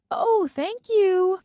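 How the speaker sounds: high, slow grateful thanks; cheerful worker. A narrator's reading. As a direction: thank her brightly and slowly. Airport worker thanks her.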